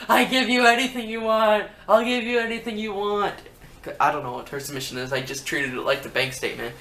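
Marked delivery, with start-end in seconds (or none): 0.1-3.3 higher, mock desperate pleading; a hint of a desperate general manager. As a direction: beg like a beaten boss giving in. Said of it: "He's like".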